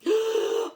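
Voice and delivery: loud high gasp of shock; sudden shocked gasp; sharp gasp. Real, not acted. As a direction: gasp as if remembering something. Sudden realisation.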